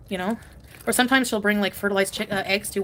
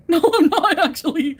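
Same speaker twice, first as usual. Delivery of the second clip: louder, higher, laughing; mock outburst; 7 semitones higher, 7 dB louder than usual. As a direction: blurt the joke answer. Mock answer, loud laughing.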